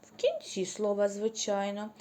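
ordinary delivery

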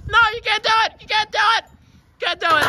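high, loud protest at the wrestler; high shout. Real, not acted. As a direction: stop him with a yell.

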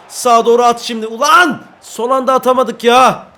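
high, loud shout; loud angry outburst at a missed pass; frustrated yell. Real, not acted. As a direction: shout as the pass slips away. Pass fails at last moment.

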